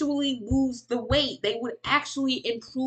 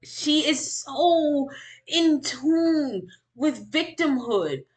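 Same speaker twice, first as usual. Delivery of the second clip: slow emphasis; 4 semitones higher, much slower than usual. Real, not acted.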